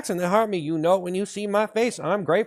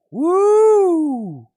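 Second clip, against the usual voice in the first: excited cheer; 11 semitones higher, 6 dB louder than usual. Acted. Hype cheer.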